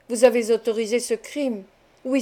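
ordinary delivery, nothing marked

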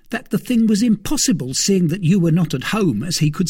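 a jittery valet blurting out news; frightened servant Planchet. A narrator's reading. Planchet voiced by narrator.

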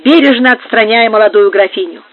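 usual speaking voice

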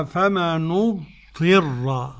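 "فمن اضطر" slowly as famanu-dtirra; u on the nun, i on the ta in "فمن اضطر"; Abu Ja'far reading. Shown as a demonstration. Sheikh showing Abu Ja'far's reading, slow famanu-dtirra. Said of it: "ضم النون وكسر الطاء".

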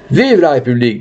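rallying political cry; lifted, ringing call of acclaim; crowd cheer. Acted. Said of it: "dit une voix".

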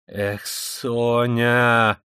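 long, slow exasperated sigh of a cry; irritated cry. Acted. Killer cutting Sonya short, irritated. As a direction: draw it out in irritation. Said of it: "вскрикнул он раздражительно".

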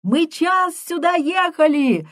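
high desperate shout; shouting through blizzard. Acted. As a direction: yell over the storm wind. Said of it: "сквозь вьюгу кричит Гуревич".

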